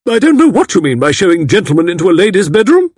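put-on old aunt angrily scolding the matron; angry old lady. A narrator's reading. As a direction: rebuke the matron in outrage. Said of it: "addressed Miss Packard angrily".

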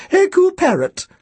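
put-on thin, very high old-woman voice; high-pitched elderly shopkeeper; Missus Bodega. A narrator's reading. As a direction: trill the name like a birdlike old lady. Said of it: "Her voice was thin and high-pitched".

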